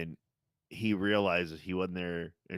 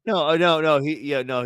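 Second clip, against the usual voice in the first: much louder, higher burst of no; flustered denial; 8 semitones higher, 8 dB louder than usual. Real, not acted. Friend correcting the messenger confusion, loud flustered denial. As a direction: blurt it out, caught off guard.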